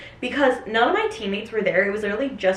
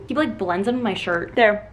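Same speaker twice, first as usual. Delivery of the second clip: ordinary talk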